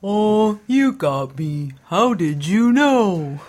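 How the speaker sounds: high, slow, swooping goofy confession; drawn-out singsong owning up; invented street voice. Acted. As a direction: stretch every word in a dopey cartoon pitch. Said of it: "and Lawrence Avenue says".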